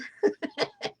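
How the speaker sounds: high delighted laugh. Real, not acted. Delighted by the card.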